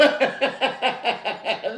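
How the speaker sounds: a real burst of laughter at a friend. Real, not acted.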